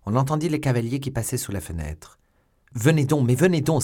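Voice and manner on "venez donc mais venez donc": loud, high, insistent shouting; urgent cry. A narrator's reading. Milady dragging the young woman away, urgent shout. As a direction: urge her along, voice raised. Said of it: "s'écriait Milady".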